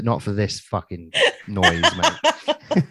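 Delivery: words broken by laughter; laughing. Real, not acted. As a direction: laugh through the line. Laughing through the punchline.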